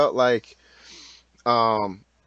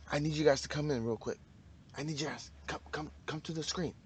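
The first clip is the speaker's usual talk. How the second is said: hushed; 11 dB quieter than usual. Acted. Calling viewers close, secret.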